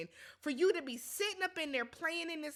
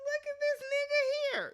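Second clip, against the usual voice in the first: pitched up, incredulous; high sassy quote; 13 semitones higher, 4 dB louder, faster than usual. Retold or imitated. Retelling her reaction to Steven, high incredulous voice. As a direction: say it high, like you can't believe him. Acting out own retold remark.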